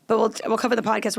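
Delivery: ordinary delivery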